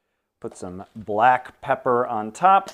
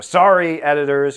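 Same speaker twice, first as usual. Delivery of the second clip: loud, higher-pitched sorry; called-out apology; 5 semitones higher, 11 dB louder than usual. Real, not acted. Cook apologizing to editors for an unplanned ingredient, called-out apology. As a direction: call it out to the crew, raised.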